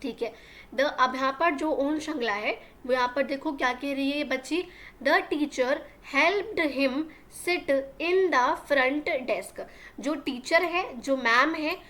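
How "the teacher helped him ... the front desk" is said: very slow, word by word for learners; slow classroom dictation reading; slow read-aloud. Shown as a demonstration. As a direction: spell the sentence out slowly for the class. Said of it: "teacher reads the line".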